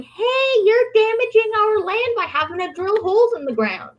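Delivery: high mock shout of outrage; mock protester. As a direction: squeal like an indignant protester. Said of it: "somebody would be there going".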